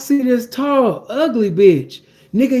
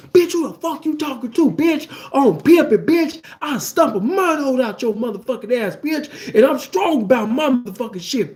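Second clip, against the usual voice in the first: furious pimp yell; 5 semitones higher than usual. Retold or imitated. Enraged pimp threatens the woman.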